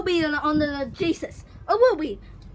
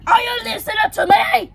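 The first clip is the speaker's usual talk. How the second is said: loud, higher scolding yell; pretend cop yelling; 5 semitones higher, 7 dB louder, faster than usual. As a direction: shout at the prisoner like a strict cop.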